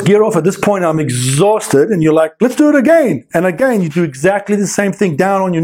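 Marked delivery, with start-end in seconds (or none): 2.4-3.2 high, chirpy instructor voice; mock cheery instructor. Student mimicking instructor, chirpy and high. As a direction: parody the upbeat teacher.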